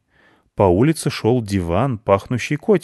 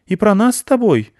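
put-on high, loud childish question; a small girl hoping she's in a book; piping hopeful ask; little girl hopeful; 13 semitones higher, 6 dB louder than usual. Аня asks eagerly.

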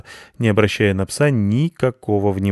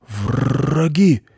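restrained long rolled-R growl; subdued dog snarl; quiet growl; 7 semitones higher than usual. A narrator's reading. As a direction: growl it under control, not shouting. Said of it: "тихо прорычал пёс".

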